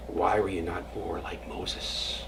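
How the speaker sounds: slow, soft whisper; hushed question. Acted. Disciple imagining God's question to Zusia, slow whisper. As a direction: hush it like a solemn secret.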